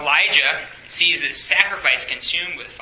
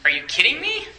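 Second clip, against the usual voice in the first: incredulous; 10 semitones higher than usual. Retold or imitated. Answering the death threat in open disbelief. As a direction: scoff as if it's absurd. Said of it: "What would you say?".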